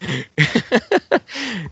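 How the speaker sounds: real, high-pitched burst of laughter. Real, not acted. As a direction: crack up at a friend's remark. Host laughs at co-host's joke.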